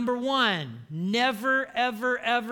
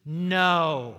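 firm no; 4 semitones lower, 6 dB louder than usual. Shown as a demonstration. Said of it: "Say that with me".

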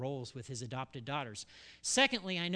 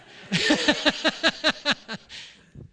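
big high burst of laughter; loud laugh; 10 semitones higher, 13 dB louder than usual. Real, not acted. Pastor laughing at his near-blowup over the license plate, big laugh.

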